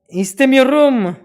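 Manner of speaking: a high, loud, put-on Turkish cry; loud mock Turkish outburst. To the stranger who picked Turkish, a loud mock Turkish outburst. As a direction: blurt it out like an upset local.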